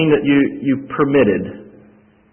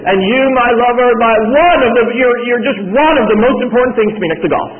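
higher and louder, hammed-up tender vow; mock wedding vow; 12 semitones higher, 6 dB louder than usual. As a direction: ham up a clueless groom's vow. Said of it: "Can you imagine saying that to your fiancé".

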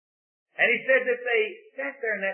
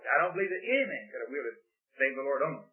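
ordinary talk

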